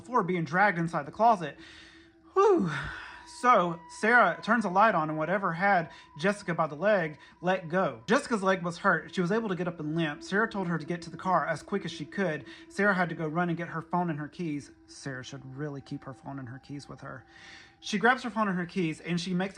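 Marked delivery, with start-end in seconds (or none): 2.3-2.7 loud, high-pitched exhale; high whew. Real, not acted. Relief after tense story moment. 14.9-17.2 low, soft side comment; wry aside. Real, not acted.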